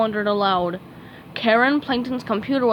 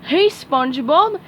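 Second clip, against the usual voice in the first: calling out; 5 semitones higher, 4 dB louder than usual. A narrator's reading. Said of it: "he called".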